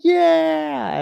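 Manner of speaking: very high, drawn-out whoop; all excited. Retold or imitated. Teammate cheers back at the recovered driver, all excited. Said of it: "I get all excited".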